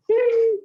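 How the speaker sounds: high bright chime, sung on one note; ping it like a little app notification; a playful mock timer chime; imitated timer ping. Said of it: "just a polite reminder".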